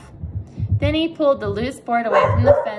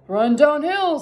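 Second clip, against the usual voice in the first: cows suggesting; 5 semitones higher than usual. A narrator's reading. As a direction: call it out as a helpful cow, lightly played. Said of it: "suggested the cows".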